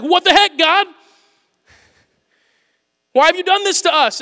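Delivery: high, loud, outraged protest; indignant protest. Pretend. People protesting to God, loud and high. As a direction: demand an answer in disbelief.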